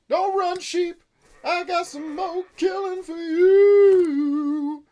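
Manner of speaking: high, loud call with stretched words; drawn-out, high-pitched mock threat; playful chasing holler. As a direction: yell after the fleeing sheep. Calling after fleeing game sheep.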